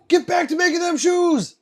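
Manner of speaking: high, loud hammed-up yell; big pretend shout at the workers; mock boss shout. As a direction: bark it like a joking slave driver. Reads a chat joke, shouted.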